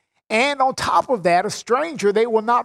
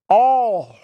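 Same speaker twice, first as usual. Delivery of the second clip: "All" louder, set apart by a pause; stress on ALL; 5 dB louder than usual. Shown as a demonstration. Preacher to listeners, singling out the word all. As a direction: hit "All" and hold before going on. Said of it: "notice the word all".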